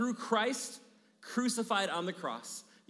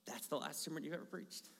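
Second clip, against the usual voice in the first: muttered despair; 4 semitones lower, 14 dB quieter than usual. Retold or imitated. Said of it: "back there going".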